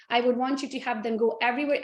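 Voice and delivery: ordinary talk, nothing marked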